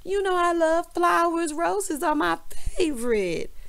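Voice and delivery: high, drawn-out mock delight; gushing wife impression. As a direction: imitate a wife cooing over her present. Voicing wife delighted with a rose.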